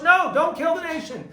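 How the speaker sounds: high, loud protest; urgent intercession. Acted. Moshe begging God to spare the nation, urgent intercession. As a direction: cry out to stop it. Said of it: "He intercedes on their behalf".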